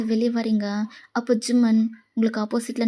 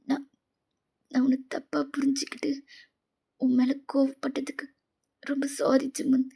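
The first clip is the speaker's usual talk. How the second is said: hesitant and hushed, near tears; small, remorseful, halting; tearful apology; 2 semitones higher, 5 dB quieter than usual. Acted. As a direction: apologise softly, voice falling to a whisper. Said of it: "உங்க கண்ணுல டியர்ஸை பார்த்ததுமே".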